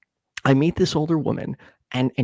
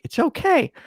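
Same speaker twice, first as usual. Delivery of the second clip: reassuring; 12 semitones higher than usual. Retold or imitated. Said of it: "She's like".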